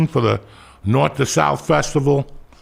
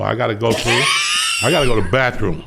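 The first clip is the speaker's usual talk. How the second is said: a hint of a polite gentleman, lower and quicker; mock polite gentleman; 6 semitones lower, faster than usual. Man holding a gun asks protesters to let him through, mock polite. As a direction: ask sweetly like a gentleman with a gun. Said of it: "go out like a gentleman and go".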